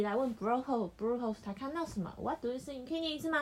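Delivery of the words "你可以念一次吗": ordinary talk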